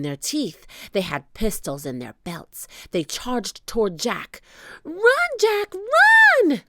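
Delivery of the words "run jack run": high, loud warning building on the last word; desperate high-pitched shout; crying out. A narrator's reading. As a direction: yell high, urging a friend to flee. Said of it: "cried Annie".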